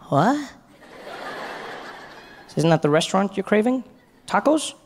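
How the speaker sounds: put-on high, puzzled American accent; American friend. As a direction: question him in disbelief, hammed-up American. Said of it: "my first American friend".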